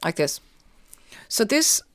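talking through mouth tape; mouth taped. Real, not acted. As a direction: lips held together by tape. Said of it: "now if I'm sounding a bit weird, I have a tape on my mouth".

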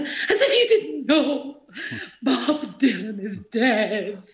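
put-on slow, high, wounded teen through laughter; half-laughing imitation of a sulking teenager; laughing mock despair. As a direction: send up her own heartbreak. Distressed teen answers her mother.

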